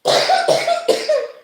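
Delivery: real harsh coughing fit after laughing; asthma coughing fit. Real, not acted. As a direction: cough through a laugh-triggered asthma attack. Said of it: "I go into asthma attacks".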